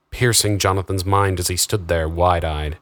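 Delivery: usual speaking voice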